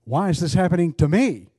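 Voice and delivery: ME hit loud and high at the end; stress on ME. Shown as a demonstration. Preacher showing the church different phrasings, stress on "me". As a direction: punch the last word, me. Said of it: "can be phrased in several different ways".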